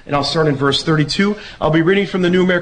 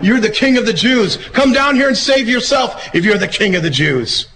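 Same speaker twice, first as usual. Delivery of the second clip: mocking; 7 semitones higher than usual. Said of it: "And they began to mock him".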